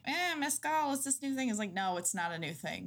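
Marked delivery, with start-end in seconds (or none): 0.1-1.5 high smug mock voice; mocking trendy voice. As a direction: play the know-it-all hipster. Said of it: "everybody's like".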